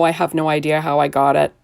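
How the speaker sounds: normal talking voice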